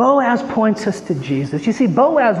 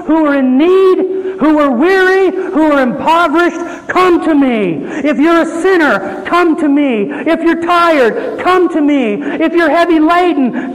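raised, higher-pitched pulpit voice; impassioned preaching; 8 semitones higher, 4 dB louder than usual. Acted. Preacher calls the weary and sinners to Christ, impassioned. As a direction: preach the invitation in ringing, repeated appeals.

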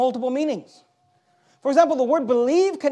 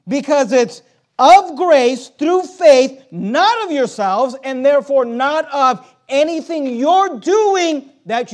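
slow, loud, every word weighed; preacher hammering grace through faith; slow emphatic preaching; 3 semitones higher, 5 dB louder, slower than usual. Acted. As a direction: drive each phrase home slowly. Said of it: "Let's put the emphasis where God puts the emphasis".

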